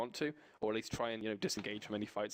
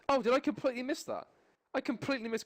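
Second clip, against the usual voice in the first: surprised; 11 semitones higher, 4 dB louder than usual. Real, not acted. Caster realizes missed kill.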